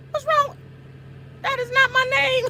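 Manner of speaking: exasperated high whine at Paul; "That is not my name" with "not" stressed, all pitched high; high-pitched protest. Shown as a demonstration. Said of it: "repeats the complaint".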